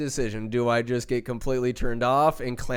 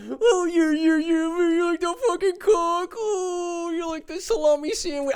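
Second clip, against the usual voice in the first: high, strained mock sex talk; over-the-top moaning. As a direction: squeal it like a porn star. Mocking his own sex talk.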